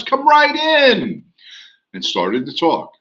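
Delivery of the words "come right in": welcoming. Retold or imitated. Greeting couple at open house.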